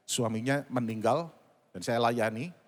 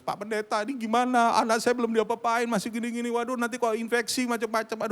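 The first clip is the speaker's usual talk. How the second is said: high, pleading mock wail; imitated tearful wail; 9 semitones higher, 3 dB louder than usual. Mother phoning pastor about untreated child, imitated crying plea. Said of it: "Dia telepon saya menangis-nangis".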